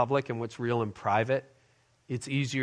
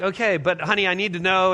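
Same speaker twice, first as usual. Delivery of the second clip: mildly agitated husband, raised and louder; retold frustration; 5 semitones higher, 4 dB louder than usual. Husband pressing wife over the map, mildly frustrated. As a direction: press the point with strained patience. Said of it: "I'm getting frustrated".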